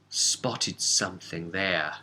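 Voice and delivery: ordinary delivery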